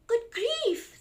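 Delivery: high, loud exclaim of surprise; storybook parent, taken aback; startled parent. Acted. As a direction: gasp out the parent's alarm. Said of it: "said Large".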